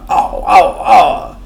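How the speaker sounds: husky animal howls; great gruff wolf. A narrator's reading. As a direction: bellow gruffly like a singing wolf. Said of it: "with his great gruff".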